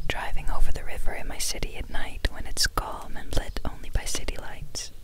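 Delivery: usual speaking voice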